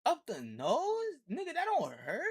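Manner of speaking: high-pitched incredulous questions; baffled and appalled, voice shooting up; real disbelief. Real, not acted. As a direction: react in stunned, squeaky disbelief. Shocked reaction to scalpel hidden up nose.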